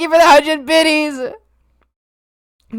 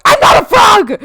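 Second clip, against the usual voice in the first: sudden loud, higher outburst; indignant shout; 5 semitones higher, 9 dB louder than usual. Acted. Streamer denies being a frog to chat, indignant shout. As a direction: burst out in protest.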